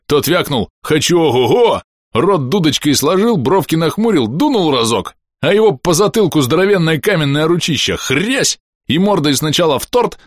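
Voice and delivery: cheeky sidekick spinning a tale; Bom, gleeful storyteller. Acted. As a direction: relish the slapstick, blow by blow. Voices Bom telling a comic story.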